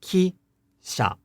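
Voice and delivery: ki-sha in two separate, fully voiced syllables; said slowly. Shown as a demonstration. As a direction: draw out ki, high, then drop on sha. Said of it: "If we say this word slowly".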